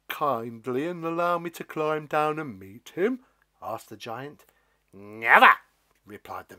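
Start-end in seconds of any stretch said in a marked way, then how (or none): 0.1-3.2 shy, pleading giant voice; hope he'll say yes; a friendless giant asking nicely; lonely giant. Acted. Giant asks politely. 4.9-5.5 loud refusal. Acted. Stalk refuses giant.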